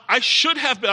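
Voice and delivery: usual speaking voice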